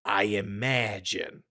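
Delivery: "imagine" drawn out slowly and deliberately; stress on imagine. Real, not acted. Host hedges his point to Nick, heavy stress on "imagine". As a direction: lean heavily on "imagine", stretching it out. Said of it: "heavy emphasis on the word imagine".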